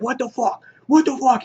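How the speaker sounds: high-pitched imitated outburst of disbelief; mock freak-out. Negan cursing as a man falls into the trench, mock freak-out. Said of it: "He's, like, freaking out".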